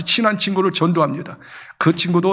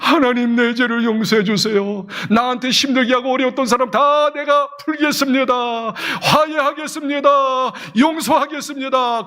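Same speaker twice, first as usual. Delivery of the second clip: rising, earnest plea to God; praying for forgiveness, rising fervently; fervent prayer; 5 semitones higher than usual. Acted. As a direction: pour it out to God, building fervently.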